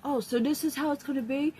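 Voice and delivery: high, loud, sassy dog voice; dog's voice. Retold or imitated. The dog talking back to her owner, high and sassy. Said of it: "she looks at me like".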